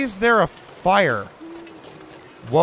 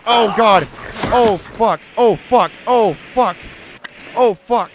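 louder, higher repeated swearing; panicked cursing; 5 semitones higher, 5 dB louder than usual. Real, not acted. Streamer caught by wolves, louder panicked swearing. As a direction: swear in real alarm, over and over. Panic during wolf attack.

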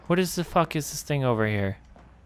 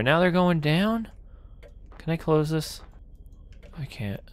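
usual speaking voice

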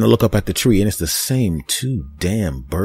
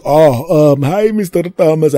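higher, louder caught-out greeting; nervous car wash guy; 8 semitones higher, 5 dB louder than usual. Retold or imitated. Car wash guy caught by the angry customer, nervous high voice. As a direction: squeak it like you've been caught. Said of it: "he goes".

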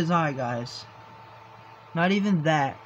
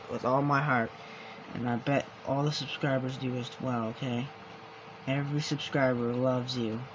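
tender comfort; 4 semitones lower, 7 dB quieter than usual. Real, not acted. Comforting his cat tenderly.

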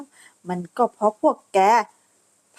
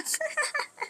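mocking laugh; 13 dB quieter than usual. Mocking noble lady laughs.

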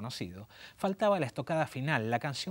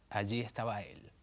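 ordinary delivery

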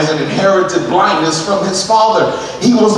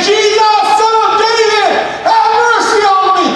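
desperate shout. Acted. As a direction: shout it out with everything. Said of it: "And he said".

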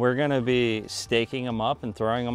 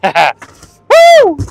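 loud laugh and high whoop; high, very loud celebratory burst; excited whoop; 13 dB louder than usual. Real, not acted. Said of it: "I don't mean to yell".

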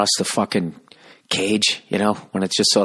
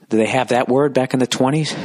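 ordinary talk, nothing marked

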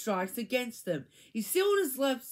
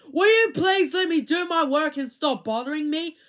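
louder, higher exasperated outburst; angry plea; 5 semitones higher, 7 dB louder than usual. A narrator's reading. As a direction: snap at the pesky classmate. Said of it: "Todd was very angry".

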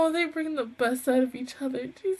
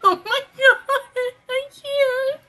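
blubbering; 10 semitones higher, 4 dB louder than usual. Real, not acted. Sobbing over sweet scene.

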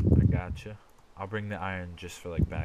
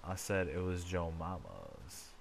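ordinary talk

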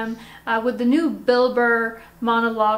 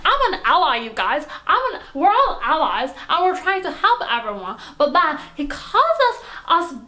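high-pitched, hammed-up outraged rant; triggered Karen; 6 semitones higher than usual. Karen complains to the host about being called names, high outrage. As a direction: protest in a pitched-up, offended Karen voice. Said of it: "a friend of mine who is very triggered".

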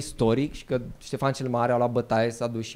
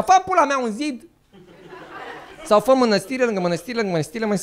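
exasperated shout; 7 semitones higher than usual. Acted. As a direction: yell the advice in frustration. Exasperated outburst at Ștefan.

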